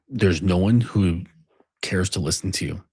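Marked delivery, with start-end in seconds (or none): none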